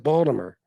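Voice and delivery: "Baltimore" as Bawl-duh-mer; local pronunciation of Baltimore. Shown as a demonstration. Agreeing with a viewer's spelling, "Baltimore" as Bawl-duh-mer. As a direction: reduce the end of "Baltimore" to -mer. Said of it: "they pronounce it".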